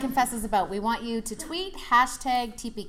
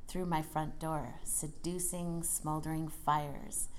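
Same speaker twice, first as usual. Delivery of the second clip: poem reading; 5 semitones lower, 5 dB quieter than usual. Acted. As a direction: linger on each line seductively. Reads erotic poem aloud.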